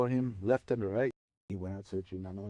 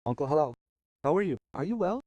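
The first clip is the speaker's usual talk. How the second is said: higher, louder, lifted questions; eager greeting; 5 semitones higher, 5 dB louder, faster than usual. A narrator's reading. Young shepherd greets a livestock owner, eagerly. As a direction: hail him politely, pitch climbing with each question.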